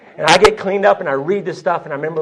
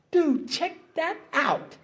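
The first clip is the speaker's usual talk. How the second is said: high, drawn-out, soft exclamation; stretched-out awed discovery; amazed aside; 11 semitones higher, 6 dB quieter, much slower than usual. Retold or imitated. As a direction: marvel to a buddy, slow and high-pitched. Amazed aside to buddy, acted out.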